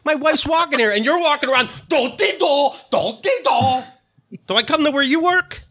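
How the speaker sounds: high-pitched comic rant; mock freak-out. Star ranting at paparazzi about his wife, hammed-up indignation. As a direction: pretend to be fed up with photographers, voice way up.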